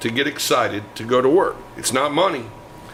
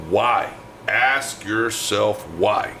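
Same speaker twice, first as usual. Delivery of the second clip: ordinary delivery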